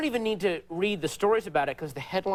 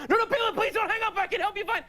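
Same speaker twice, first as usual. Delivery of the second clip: very high, fast panicked begging; frantic pleading; faster than usual. Retold or imitated. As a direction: beg him desperately, hammed-up. Acts begging caller to stay.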